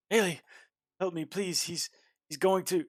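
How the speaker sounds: high, loud desperate woman's cry; terrified captive calling to Haley; crying out for help. Acted. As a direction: plead for help in panic, voice way up. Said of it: "a familiar voice, uh, that cries out".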